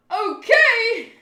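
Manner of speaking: very loud, high exclamation; high acted shout; alarmed yell. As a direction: shriek the word in disbelief. Acted alarmed manga reaction.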